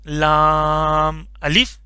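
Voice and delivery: لام الف read slowly, each name stretched; slow lam alif. Shown as a demonstration. Teacher reads the joined letters for pupils, slowly. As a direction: draw out لام الف syllable by syllable. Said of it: "یہ الف ہو گیا".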